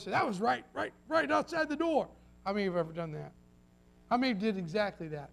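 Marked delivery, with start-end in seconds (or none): 0.1-2.1 startled exclamation. Acted. Acting out startled thunder remark.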